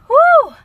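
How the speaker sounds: a high, loud laugh that bursts into a whoop. Real, not acted. Choked up while talking about self-worth, she lets out a loud laughing whoop. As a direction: shake off the tears with a big laughing whoop.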